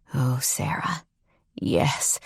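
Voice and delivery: soft breathy whisper. Acted. Ethan whispering Sarah's name, soft whisper. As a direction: whisper her name tenderly. Whispered love-making lines.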